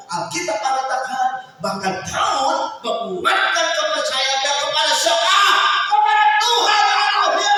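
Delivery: rousing sermon crescendo; impassioned preaching. Real, not acted. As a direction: build from urgent preaching to a huge, high sustained shout. Preaching builds to full force.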